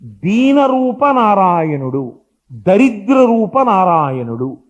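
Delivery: slow, loud oratory; rousing speech. Retold or imitated. Vivekananda addressing the nation, slow and loud oratory. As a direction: thunder it slowly to the nation. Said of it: "ఈ మాట చెప్పారు".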